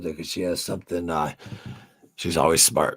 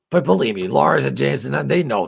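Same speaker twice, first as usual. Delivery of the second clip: loud and insistent, driving the point home; emphatic loud; 7 semitones higher, 7 dB louder than usual. Real, not acted. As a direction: hammer it home, louder. Emphatic claim about band.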